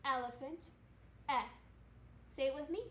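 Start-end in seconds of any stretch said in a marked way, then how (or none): 1.3-1.4 "eh." as a short, clipped e vowel; short e sound. Shown as a demonstration. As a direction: say "eh." like the e in elephant. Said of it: "So E, elephant".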